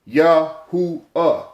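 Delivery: "Yahuwah" slowly split as Yah-hoo-uh; three separate sounds. Shown as a demonstration. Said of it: "That's three".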